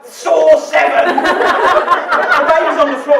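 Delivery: a midwife laughing as he relives the floor birth; laughing through the story. Real, not acted. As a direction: tell the shock while laughing loud. Laughing while retelling the birth.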